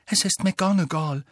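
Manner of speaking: usual speaking voice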